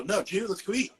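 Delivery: high, slurred "no, joo? skweet"; words jammed together. Acted. Friends answering him, DID YOU squashed to "joo". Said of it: "we jam all our words together".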